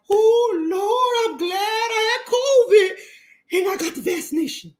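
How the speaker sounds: high, laughing and sobbing as a hammed-up grateful believer; mock pious relief. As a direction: ham up a tearful churchgoer praising doctors, voice up high. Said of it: "it's like".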